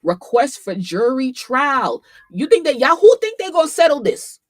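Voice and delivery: high, loud, rising excitement; loud excited exclamation. Real, not acted. Streamer seeing a jury trial request, loud excitement. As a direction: crow it out to the viewers.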